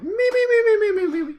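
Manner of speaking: very high, loud squeaking "me, me, me" through laughter; mole rat squeaks. Retold or imitated. Recruit forced to make mole rat noises under his blankets, shrill squeaks. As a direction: squeal like a tiny animal while cracking up.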